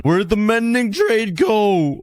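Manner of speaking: high, slow, whining question; whiny disbelief. Real, not acted. Player to viewers, whining high in disbelief. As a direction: whine like the trade was stolen. Trade vanished.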